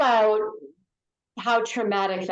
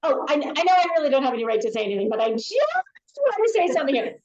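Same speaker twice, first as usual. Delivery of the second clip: mock timid apology, rushed and then higher; apologetic; 4 semitones higher, much faster than usual. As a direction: hedge nervously, rushing the start, then rise. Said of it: "apologizing for speaking before you even speak".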